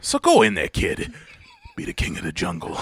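threatening impression dropping to a soft finish; Christopher Walken. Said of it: "when Walken gets quiet and threatening".

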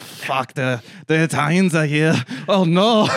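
dismayed comic dictator, rising in pitch; hammed-up dread at unwelcome visitors; Hitler. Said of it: "Hitler's like".

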